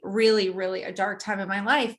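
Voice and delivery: ordinary talk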